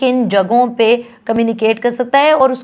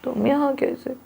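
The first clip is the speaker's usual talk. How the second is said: soft tone; 2 semitones higher, 9 dB quieter than usual. Shown as a demonstration. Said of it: "मुख्तलिफ tone में बोल के दिखाती हूँ".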